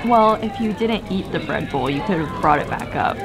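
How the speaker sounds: ordinary talk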